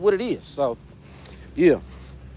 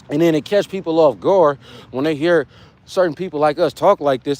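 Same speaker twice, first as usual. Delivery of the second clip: normal talking voice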